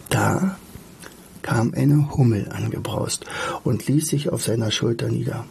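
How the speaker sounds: measured, expressive storybook reading; story narration. A narrator's reading. Narrator tells of Alltag shedding two clinging companions, story narration. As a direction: read the tale with deliberate pauses.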